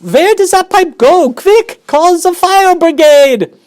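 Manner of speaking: very high, loud frantic demands; a panicking mother yelling for help. Acted. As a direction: shout for the fire brigade. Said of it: "yelled Missus Gloop".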